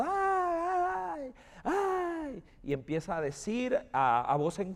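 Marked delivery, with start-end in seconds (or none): 0.0-2.4 high-pitched mock wails, held back in volume; imitated screams. Pastor mimics the neighbour screaming at what she did, imitated high screams. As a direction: draw out high, imitated cries of "ay". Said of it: "empieza a pegar gritos".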